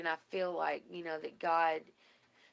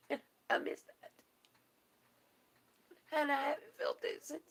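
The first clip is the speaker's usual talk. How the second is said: higher, choked-up voice breaking into whisper; real tearful crying; 7 semitones higher than usual. Real, not acted. Missing a week of belonging, real tearful crying. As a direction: fight through tears as the words give out. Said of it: "I'm sorry to start bawling".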